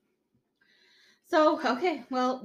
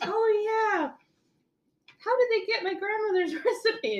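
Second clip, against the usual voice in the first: retold Phoebe; 13 semitones higher, 5 dB louder than usual. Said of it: "And she's like".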